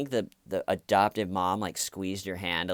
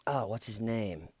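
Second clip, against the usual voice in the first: ordinary talk, nothing marked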